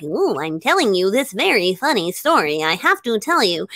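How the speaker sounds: mock spooky monster with swooping pitch; sing-song little monster impression. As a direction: imitate a kid's monster voice, pitch rising and dipping. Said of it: "sound like a little monster".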